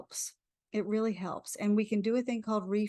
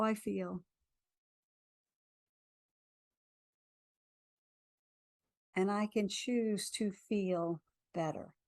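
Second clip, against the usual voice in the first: guided affirmation. Acted. Leads a tapping affirmation.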